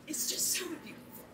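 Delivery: whispered, tearful, voice catching; almost no voice left, crying with joy; choked up with tears. Real, not acted. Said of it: "Are those tears I see?".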